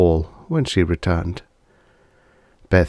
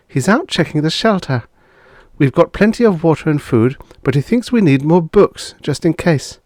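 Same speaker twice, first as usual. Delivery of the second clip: higher, brisk neighbourly woman; lightly voiced friend Lucille; 9 semitones higher than usual. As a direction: play the friend a bit higher.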